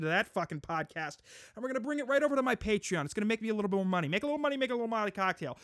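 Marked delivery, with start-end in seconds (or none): 1.5-5.6 rushed gleeful. Real, not acted. Gleeful money rant.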